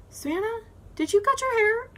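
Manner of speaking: high-pitched mock mom question; imitated mom. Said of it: "And my mom's like".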